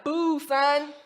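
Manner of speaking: mock New York accent booing, lightly done. Imitating the New York crowd booing Outkast, New York accent. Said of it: "if you could boo in a New York dialect".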